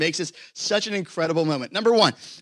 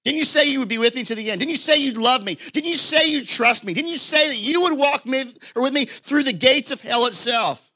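mild reproach; 8 semitones higher, 4 dB louder than usual. Pretend. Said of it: "he could be like".

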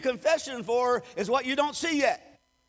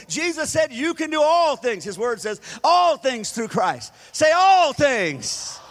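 loud, higher, ringing declaration; fervent preaching; 4 semitones higher, 9 dB louder, slower than usual. Acted. Preacher leading the church to shout, loud fervent call. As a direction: proclaim it with everything you have.

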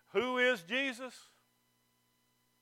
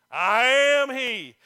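slow, louder solemn proclamation; Jesus' declaration; 9 dB louder, much slower than usual. Acted. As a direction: announce it with full weight. Jesus' answer, slow and loud.

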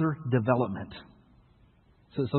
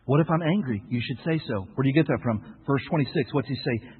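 ordinary delivery

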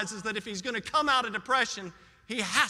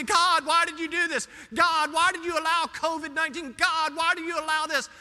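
louder, higher mock complaint; accusing God; 5 semitones higher, 5 dB louder than usual. Preacher mimicking people blaming God, loud and accusing. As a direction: wag a scolding finger as you demand answers. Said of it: "We shake our little finger at God".

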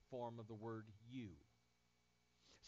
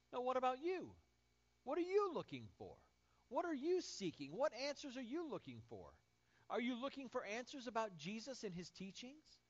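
raised, higher preaching challenge to the listeners; 8 semitones higher, 4 dB louder than usual. Acted. Preacher calling on the congregation to seek, fervent preaching. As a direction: press the congregation with each question.

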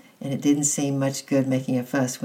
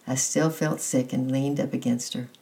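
plain reading voice

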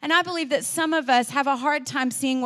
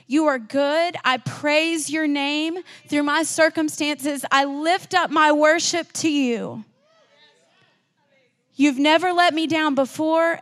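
louder, higher, fervent preaching prayer; voiced prayer; 3 semitones higher, 5 dB louder than usual. Preacher voicing a believer's prayer to Jesus, fervent and loud. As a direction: pray it out with growing conviction, rising to a full-voiced peak.

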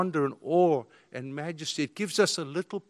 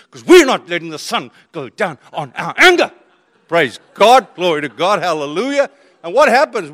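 loud, fervent sermon voice; preaching at full force; 3 semitones higher, 9 dB louder than usual. Acted. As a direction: preach it out to the crowd.

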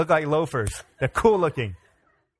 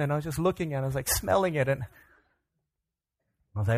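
usual speaking voice

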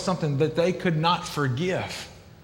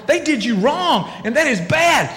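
higher, slow, indignant; higher and slower, fired up; riled-up sympathy; 8 semitones higher than usual. Retold or imitated. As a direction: stir the crowd up over the wrong done. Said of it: "we're sitting here going".

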